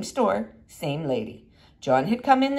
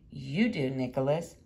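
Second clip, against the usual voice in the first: low and soft, like an echo in the head; hushed self-reminder; quiet inner voice; 7 semitones lower, 8 dB quieter than usual. Retold or imitated. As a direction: say it to yourself, half aloud. Inner echo of teacher's words.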